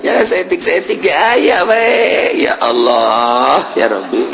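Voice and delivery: crying out to God with a breaking voice; tearful outcry. Real, not acted. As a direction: cry out Ya Allah, near tears. Emotional outcry in prayer.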